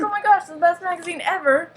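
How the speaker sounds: mock fan freak-out. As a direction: gush like an overexcited fan. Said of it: "And he's freaking out".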